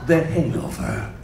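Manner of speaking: slow, sinking very low on the rating; deep begrudging trailer announcer; angry trailer voice. Acted. Trailer guy forced to market R-rated films, angry and low. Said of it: "now he's angry that he has".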